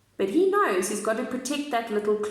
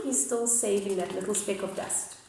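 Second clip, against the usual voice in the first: ordinary delivery, nothing marked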